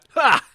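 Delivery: high, loud karate kiai; karate yell. Acted. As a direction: snap out a kiai. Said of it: "Karate kick sound, not laugh".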